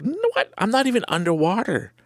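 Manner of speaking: high-pitched, baffled objection; incredulous protest. Retold or imitated. Swimmer arguing with his panicking body, incredulous. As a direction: argue back in disbelief, pitched well up.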